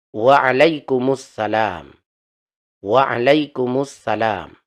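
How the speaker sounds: Waalaikumsalam said slowly, syllables drawn out and joined; slow model reply. Shown as a demonstration. Teacher modelling the reply for students, slow and careful. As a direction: stretch Waalaikumsalam carefully for learners. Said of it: "yang benar bacanya".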